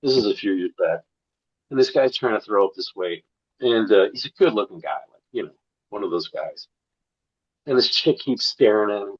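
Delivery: ordinary talk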